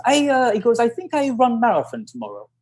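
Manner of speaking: high, tentative foreign-sounding voice; imitated eager runner. Runner announcing his plan to his coach, imitated high and tentative.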